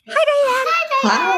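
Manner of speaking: high, loud "Hi, Diane"; high sing-song greeting. Shown as a demonstration. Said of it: "say it with me right now".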